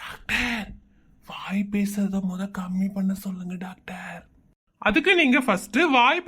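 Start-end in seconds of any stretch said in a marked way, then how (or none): 0.0-4.2 slow, hushed prompting whisper; soft, drawn-out conspiratorial whisper; secret whisper. Acted. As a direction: whisper it slyly so only the doctor hears.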